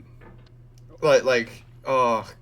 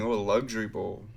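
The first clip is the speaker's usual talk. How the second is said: ordinary talk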